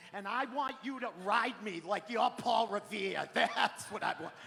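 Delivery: loud, hammed-up bedroom demands; Boston wife. Wife egging on Tommy in bed, brash hollering. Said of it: "hear her in the middle of it go".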